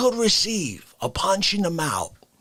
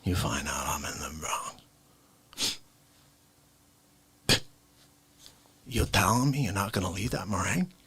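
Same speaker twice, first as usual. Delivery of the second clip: whisper; 10 semitones lower, 6 dB quieter than usual. Acted. Whispered bakery story.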